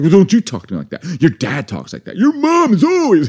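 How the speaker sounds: hammed-up comeback building to a high, loud blurt; play-acted indignant retort, rising sharply; mock quarrel. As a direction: escalate a pretend couple's fight. Acted couple's escalating fight.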